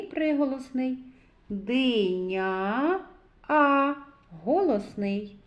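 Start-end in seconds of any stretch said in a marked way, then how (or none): 1.5-3.9 drawn-out "Диня", then a lone "А"; sounding out. Shown as a demonstration. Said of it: "зробимо звуковий аналіз слова".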